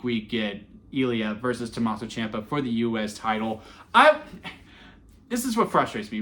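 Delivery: ordinary talk, nothing marked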